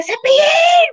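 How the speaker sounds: very high shouted name; passing shout. Retold or imitated. A passing fan shouts at the clown, very high yell. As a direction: yell it out the car window.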